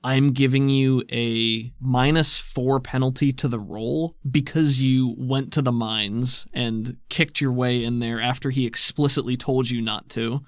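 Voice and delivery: usual speaking voice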